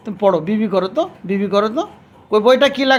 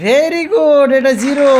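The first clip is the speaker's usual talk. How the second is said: excited praise; 4 semitones higher, 7 dB louder, much slower than usual. Real, not acted. Praising child's right answer.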